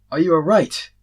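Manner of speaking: high, loud worried cry; alarmed call. A narrator's reading. Azrael rushing to the collapsed warrior, loud alarmed call. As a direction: call out in alarm to the fallen man.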